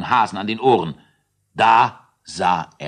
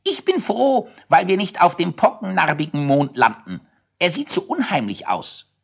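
put-on high child voice; 10 semitones higher than usual. Child character's scared remark.

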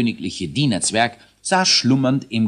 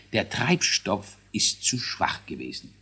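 ordinary delivery

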